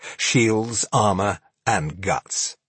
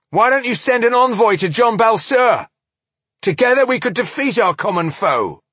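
high, shouted urgent offer; 13 semitones higher, 5 dB louder than usual. Acted.

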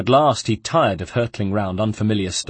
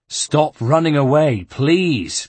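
loud, slow, raised plea; exasperated pleading; 5 semitones higher, 5 dB louder, slower than usual. A narrator's reading. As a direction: beg him slowly and loudly. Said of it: "pleaded Ezio".

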